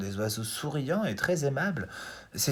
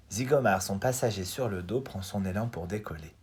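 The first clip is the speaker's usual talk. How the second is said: ordinary delivery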